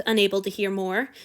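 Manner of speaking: ordinary delivery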